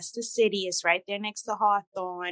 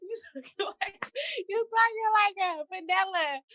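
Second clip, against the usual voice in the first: teasing friends' voice; 10 semitones higher than usual. Retold or imitated. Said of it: "say that".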